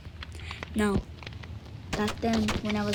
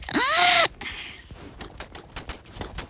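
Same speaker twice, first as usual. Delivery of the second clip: sharp high gasp. Acted. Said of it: "Sorry, I do that a lot".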